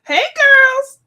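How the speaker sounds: imitating his high, loud hello; exaggerated happy high greeting. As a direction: call out cheerfully high, mimicking him. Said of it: "Joe's so happy. Joe's like".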